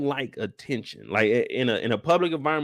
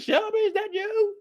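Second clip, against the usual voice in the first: high falsetto call of the man; mock high squeal calling her name; imitating Q. Man spotting Shelby at the gate, high mock call. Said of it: "He like".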